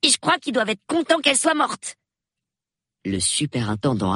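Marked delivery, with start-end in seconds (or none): 0.0-2.0 put-on a boy's voice, pitched higher, brightly pleased with himself; cheerful. A narrator's reading. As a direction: announce it gaily, like good news. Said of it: "conclut-il gaiement". (gaiement, voix d'enfant aiguë)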